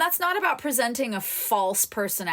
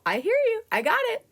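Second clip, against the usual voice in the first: higher, lightly voiced calm reply; reassuring self-talk; 14 semitones higher, faster than usual. Coach voicing talking back to doubt, lightly reassuring. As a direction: soothe the doubt, a touch mock. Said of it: "you go".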